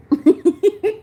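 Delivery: high-pitched, loud tittering laugh; quick high tee-hee laugh; high giggle. Real, not acted. Amused while calling a listener.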